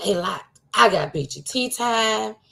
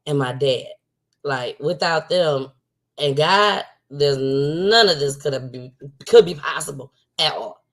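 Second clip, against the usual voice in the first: ordinary delivery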